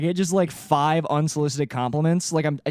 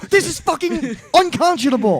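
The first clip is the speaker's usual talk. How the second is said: mock outrage; 10 semitones higher, 4 dB louder than usual.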